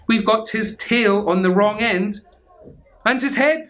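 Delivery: little skeleton. Acted. Little skeleton noticing the dog's parts are wrong, high alarm. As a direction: rise in alarm at the mistake. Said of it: "said the little skeleton".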